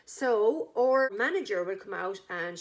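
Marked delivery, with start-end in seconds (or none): none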